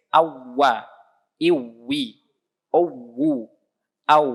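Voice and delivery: Awwa, iwi, uwu with a small push up on the doubled w. Shown as a demonstration. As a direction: lift the pitch a little on the ww of Awwa. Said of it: "bacanya agak ditinggiin sedikit".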